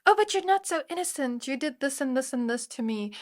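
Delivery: quick, high-pitched mock protest; put-on defensive whine, starting high then dropping. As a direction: imitate a victim justifying themselves. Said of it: "I feel them being so defensive".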